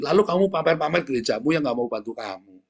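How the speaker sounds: ordinary delivery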